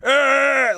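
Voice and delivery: very high crunchy throat growl; gritty growl. Shown as a demonstration. Singer demonstrates the grit sound, high gritty growl. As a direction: lean hard into the grit on one held noise. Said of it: "like that, that sound".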